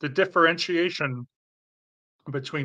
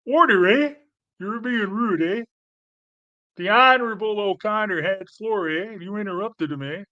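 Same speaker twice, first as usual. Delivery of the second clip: lampoon a prim Canadian chair calling order, eh; mock Canadian Speaker; 6 semitones higher than usual. Mocking polite Canadian parliament speaker.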